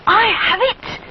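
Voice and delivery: high, bright-eyed little bear cry; excited exclaim. A narrator's reading. Little bear realising his plan, excited. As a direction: burst out with the idea. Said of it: "he exclaims excitedly".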